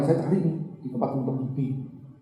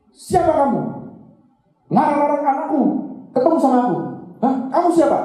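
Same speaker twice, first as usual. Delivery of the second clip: furious confrontation; 10 semitones higher than usual. Retold or imitated. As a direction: blow up at the man who kept them apart. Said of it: "Marah sih itu".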